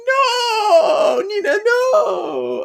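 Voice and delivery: very high, slow cry of despair; anguished crying shout. Retold or imitated. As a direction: wail it as if losing everything. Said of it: "And she's like".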